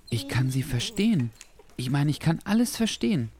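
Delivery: put-on high-pitched amazed boy voice; disbelieving. A narrator's reading. Boy suddenly understanding the animals over the tumult, incredulous. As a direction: marvel at it, pitch climbing. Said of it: "Ungläubig brachte er schließlich".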